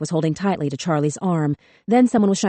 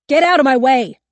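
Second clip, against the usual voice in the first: higher, much louder, frantic yelling; panicked scream; 8 semitones higher, 8 dB louder than usual. A narrator's reading. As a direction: scream it with the gun raised.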